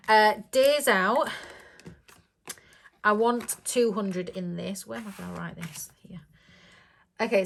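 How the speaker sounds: ordinary delivery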